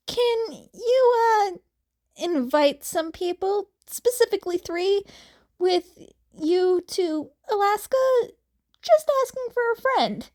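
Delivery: blurting it out awkwardly, voice shooting up; awkward asking. Acted. Hazel awkwardly asking Jason for a boat ride, high and hurried. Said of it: "Hazel awkwardly asked".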